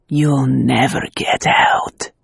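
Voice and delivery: slow, low, menacing whisper of a villain; a villain's slow whispered sneer. A narrator's reading. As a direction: breathe the threat out slowly and cruelly. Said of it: "he sneered".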